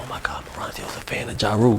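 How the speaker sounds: hushed gossiping whisper; audience whisper. Retold or imitated. Audience members murmuring shocked gossip, hushed whisper.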